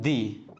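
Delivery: "दी" with a long ee; THE as "dee". Shown as a demonstration. Teacher giving the rule before vowels, "दी" with long ee. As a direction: say "दी" as a drawn-out dee. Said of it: "तो इसमें हम बोलते हैं".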